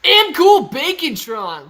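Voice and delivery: loud, high, stretched-out name call; shouted shout-out. Real, not acted. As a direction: announce the donor at full volume. Calling out a donor's name.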